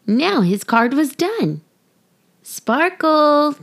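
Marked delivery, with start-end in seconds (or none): 2.4-3.5 calling out. Acted. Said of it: "called Lucy".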